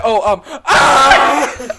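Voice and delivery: loud, high yell breaking into laughter; drawn-out hammed-up cry of pain; mock pained outburst. Co-host retelling the actress's reaction to getting hit, mock pained yell. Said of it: "She said, she said".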